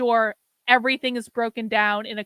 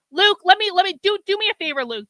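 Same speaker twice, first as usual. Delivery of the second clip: exasperated call; 6 semitones higher, 3 dB louder, faster than usual. Real, not acted. Exasperated at Luke.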